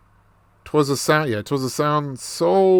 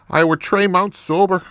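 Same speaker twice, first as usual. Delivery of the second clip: higher, lilting mock Irish brogue; Irish accent; 5 semitones higher than usual. Man proudly telling of sobriety, Irish accent. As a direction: announce it in a put-on Irish accent. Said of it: "more of this Irish accent".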